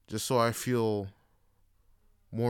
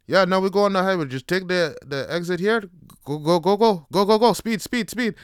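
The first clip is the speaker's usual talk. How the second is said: loud, high-pitched mock barking of orders; imitating a pushy instructor, raised voice; urgent instructor; 8 semitones higher, 9 dB louder than usual. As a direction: urge a timid driver to floor it. Instructor urging him onto highway.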